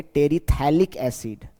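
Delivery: slow "टेरिथैलिक" with plain "th" in the middle; drawn-out "टेरिथैलिक", te-ri-thai-lik; model pronunciation. Shown as a demonstration. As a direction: say "टेरिथैलिक acid" slowly, P silent. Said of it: "इसको क्या बोलेंगे?".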